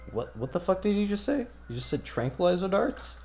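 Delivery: high-pitched, stumbling disbelief; mock-stunned, pitch well up; incredulous questioning. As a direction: echo it back as a baffled question. Shali startled by Alston's remark.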